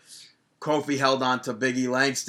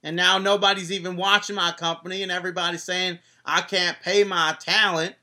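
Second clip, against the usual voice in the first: higher, louder Southern-tinged newsreader voice; Dixie Carter impression; 5 semitones higher, 3 dB louder than usual. Host slips into Dixie Carter reading the news to listeners. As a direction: play a folksy Southern news anchor, y'all and all. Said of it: "Just go into Dixie".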